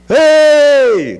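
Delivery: high, loud shout from afar. Retold or imitated. Pastor shouting to the farm from afar, loud. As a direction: yell it across the farm. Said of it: "De longe eu gritava".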